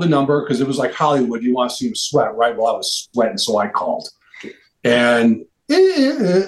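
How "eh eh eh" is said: high-pitched "eh, eh, eh" beeps; high mouth-made phone tones; imitated phone error tone. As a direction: mimic a disconnected-number signal in a high voice. Imitates disconnected-number tone on phone.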